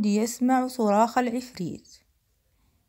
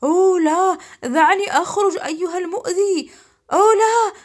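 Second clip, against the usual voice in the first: high, loud outraged yell; alarmed, furious genie cry; genie shouting; 7 semitones higher, 9 dB louder than usual. A narrator's reading. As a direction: holler to be let out. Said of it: "العفريت يصرخ".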